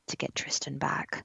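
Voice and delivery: soft unvoiced aside; whispering. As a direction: whisper it secretly.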